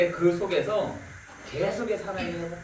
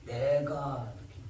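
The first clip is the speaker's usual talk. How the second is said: ordinary talk, nothing marked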